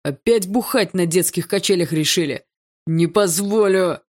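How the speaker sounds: put-on loud, outraged old watchman; shouting at trespasser. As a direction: bellow at the kids from afar. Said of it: "заорал сторож".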